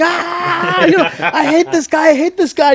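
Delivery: high-pitched mock shouting; imitating son shouting. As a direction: re-enact a worked-up kid yelling. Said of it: "my son was shouting".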